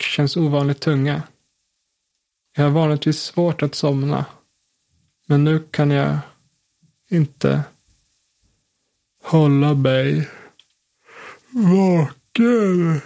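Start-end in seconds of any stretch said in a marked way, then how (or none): 5.3-13.0 slowing down as sleep takes over; drowsy. Acted. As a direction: let the words drag, fighting to stay awake. Falling asleep in the coffin.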